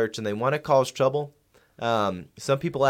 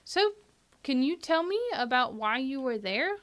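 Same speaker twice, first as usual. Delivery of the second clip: much higher, put-on host voice; high interviewer voice. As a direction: play a bright radio host. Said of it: "We're gonna do a phone interview with them".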